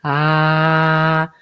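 low, held "Ah" from the heart; sustained chest "Ah". Shown as a demonstration. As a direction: hold a steady, low "Ah". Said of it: "ponerse la mano y de sentir".

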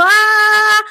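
high loud pinched Ah; high tense Ah that "no sirve"; strained shrill ah. Shown as a demonstration. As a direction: push a tight high Ah from the throat. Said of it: "si yo tengo la voz así".

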